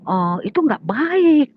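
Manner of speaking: slow, high, loud reproof; admonishing. Retold or imitated. Teller tells in-law it is not good, admonishing.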